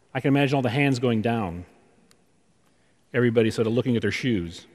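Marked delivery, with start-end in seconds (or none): none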